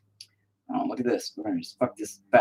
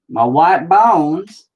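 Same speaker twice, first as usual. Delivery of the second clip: high, loud, stretched words; slow, pitched-up playful announcement; drawn-out exclamation; 9 semitones higher, 10 dB louder, much slower than usual. Pretend. Phrase drawn out, then said normally.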